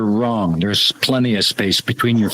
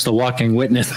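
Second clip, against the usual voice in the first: normal talking voice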